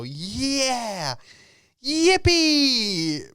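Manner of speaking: very high, loud mock yippee; mock cheering crowd. Imagined audience cheering the magic, very high and loud. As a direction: squeal it like a gushing fan.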